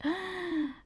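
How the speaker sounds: high, quiet gasp of realization; a small startled gasp; sharp gasp. Retold or imitated. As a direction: suck in air in shock. Realizing keto hurt her.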